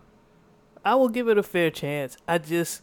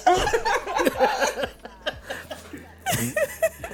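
slow, laughing, higher; words squeezed out through laughter; 9 semitones higher, 7 dB louder, slower than usual. Real, not acted. Laughing at own quote.

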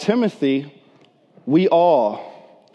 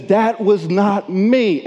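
emphatic denial; 5 semitones higher, slower than usual. Retold or imitated. Peter firmly denying Jesus to the third accuser, slow and raised. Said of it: "And he says".

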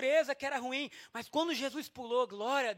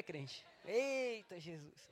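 ordinary talk

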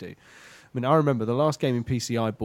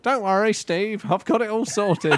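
high, louder mock superhero voice; Russ Plug; 10 semitones higher, 3 dB louder than usual. Podcaster as plug hero to Steve, high impression. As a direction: reassure the captain in a pitched-up character voice. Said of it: "Russ Plug is here".

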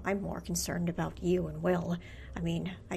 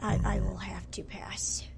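whispering. Acted. Whispered refusal.

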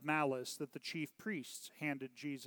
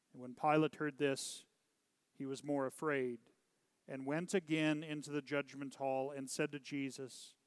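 ordinary delivery

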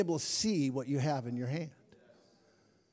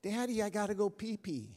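lightly voiced small girl, a bit higher; a hint of a child tugging at dad; little daughter; 5 semitones higher than usual. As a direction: daughter interrupting her father with a small voice. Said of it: "my daughter comes up to me".

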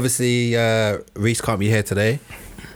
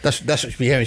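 ordinary delivery

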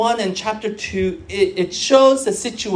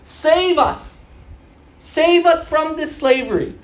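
high, raised, pleading cry; crying out to God; 7 semitones higher, 3 dB louder than usual. Retold or imitated. Enslaved people crying out to God, high pleading cry. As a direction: call out for rescue, high and insistent. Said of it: "they cried out to God".

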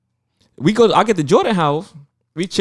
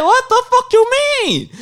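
very high, drawn-out mock outrage; cursing her out. Retelling how he cursed out the airline agent, outraged. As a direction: stretch it into a squealed, hammed-up protest. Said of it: "I was cursing the fuck out".

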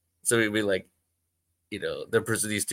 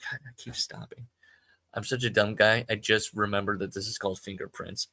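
normal talking voice

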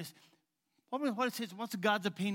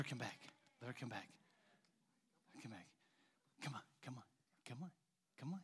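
whispered coaxing; 4 semitones lower, 16 dB quieter, faster than usual. Real, not acted. Coaxing the dog to come.

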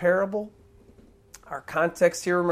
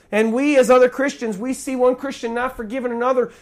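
ordinary talk, nothing marked

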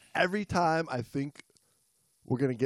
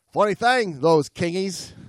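higher, louder cheerful diver's thought; voiced diver's thought; 5 semitones higher, 8 dB louder, slower than usual. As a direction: toss off the diver's musing brightly.

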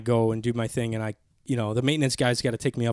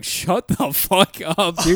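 slow, drawn-out, higher-pitched disbelief; retold amazement; 5 semitones higher, 3 dB louder, much slower than usual. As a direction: stretch it out in mock shock. Retold disbelief at Obama news.

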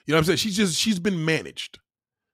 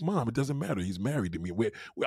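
soft dismissive daughter; 4 semitones lower, 10 dB quieter than usual. Retold or imitated. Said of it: "She's like".

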